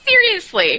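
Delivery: usual speaking voice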